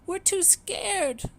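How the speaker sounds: very high, slow, small voice; a baby duck too frightened to move; tiny frightened duckling voice; scared duckling. A narrator's reading. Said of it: "they cried".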